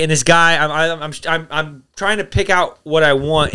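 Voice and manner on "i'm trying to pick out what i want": normal talking voice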